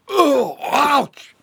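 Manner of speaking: high, loud yelp of pain; pained cry. Acted. The man cries out as Bobby Sue pummels him. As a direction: yelp as you're struck. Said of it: "cried the shadowy man".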